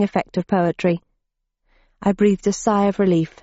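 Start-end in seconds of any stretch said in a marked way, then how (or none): none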